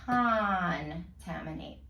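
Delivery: contaminate said very slowly, each syllable stretched apart; slow sounding out. Shown as a demonstration. Tutor re-modelling the word for the student, slow careful sounding out. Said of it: "listen again as I say the word".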